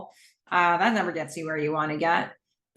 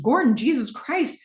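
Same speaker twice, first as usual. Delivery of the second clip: higher-pitched alarmed exclamation; mildly terrified; 7 semitones higher than usual. Acted. Cam jumping up as Gordon falls, acted alarm. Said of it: "Cam jumps up terrified".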